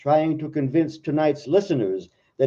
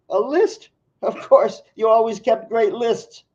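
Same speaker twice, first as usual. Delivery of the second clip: much higher, teasing wife's voice; Jean's voice; 9 semitones higher, 4 dB louder than usual. Acted. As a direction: play the wife amused by his lists. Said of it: "I'll try to make two voices".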